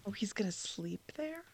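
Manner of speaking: whispering. Real, not acted. Shocked aside about sleeping there.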